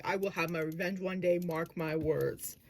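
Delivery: low threat. Shown as a demonstration. Student demos villain threatening revenge quietly. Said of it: "he would actually threaten".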